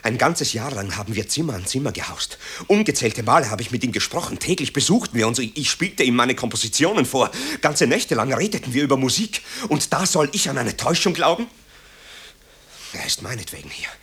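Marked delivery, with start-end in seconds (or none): 2.7-11.5 rising agitation. Acted. Marco insists, rising agitation.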